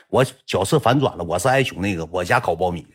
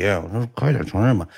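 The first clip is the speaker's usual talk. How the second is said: usual speaking voice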